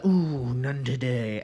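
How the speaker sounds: slow, drawn-out shopkeeper voice; a hint of a smug clerk; retelling a sketch, clerk shrugging off the customer; lazy shopkeeper. Cheese shop clerk voiced.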